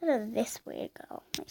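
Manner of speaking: usual speaking voice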